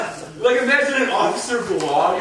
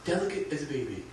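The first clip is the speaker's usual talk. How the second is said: softer, lower ad read; soft ad slogan; 6 semitones lower, 12 dB quieter than usual. Retold or imitated. Billboard slogan read in a hushed ad voice. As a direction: breathe the tagline softly. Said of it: "It was, like".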